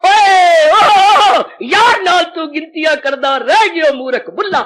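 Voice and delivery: long, high roar of "ओ" easing into a loud rebuke; flaring up. Acted. Bullah Shah rebukes himself as a fool, flaring up. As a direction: erupt in a drawn-out cry, then scold. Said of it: "कुल्ला शाह भड़क उठिया".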